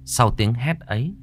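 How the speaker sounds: usual speaking voice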